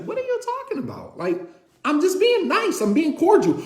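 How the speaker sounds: high-pitched, quick, incredulous squeak; baffled. Retold or imitated. Husband baffled by wife's jealousy, squeaky disbelief. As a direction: act stunned at the accusation. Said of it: "It's just like".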